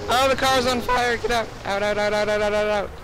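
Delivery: loud, high panicked yells; panicked shouting. Real, not acted. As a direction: shout to escape the fire. Car on fire, fleeing.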